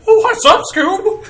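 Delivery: very high, loud Scooby-Doo cartoon voice; squeaky-high, hammed-up cartoon greeting; cartoon sidekick voice. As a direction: play it like the dog's goofy pal. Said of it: "Scooby-Doo on that".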